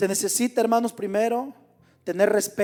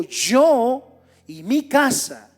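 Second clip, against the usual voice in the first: "Yo" loud, high and stretched out, then "mi casa" stressed; emphatic declaration; 4 semitones higher, 6 dB louder, much slower than usual. Retold or imitated. Preacher quoting Josué to the men of God, slow emphatic "Yo". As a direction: proclaim it slowly, leaning long on "Yo". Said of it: "así como él dijo".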